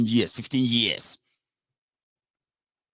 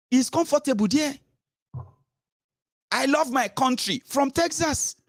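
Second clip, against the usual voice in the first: pitched-up sneering mimicry of the critic; mock him in a higher voice, sneering; 7 semitones higher than usual. Mocking the critic who lives in Texas, high sneering voice.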